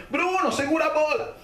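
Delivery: high-pitched retold call; urgent calling. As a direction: call out urgently to someone in the water. Calls instruction to drowning boy. (chamado urgente, agudo, encenado)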